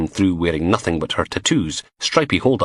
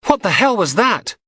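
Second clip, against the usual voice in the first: startled; 14 semitones higher, 3 dB louder than usual. Acted. Mandy startled by crash.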